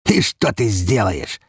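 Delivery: slow, drawn-out mocking question; sneering. A narrator's reading. Father taunting his helpless wife, sneering slowly. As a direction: taunt with a smirk, unhurried. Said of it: "усмехнулся отец".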